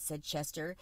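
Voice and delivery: usual speaking voice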